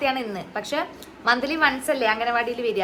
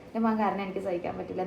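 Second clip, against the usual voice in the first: anxious sadness; 5 semitones lower, 7 dB quieter than usual. Real, not acted. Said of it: "ഞാൻ കൈയൊക്കെ തണുത്തു".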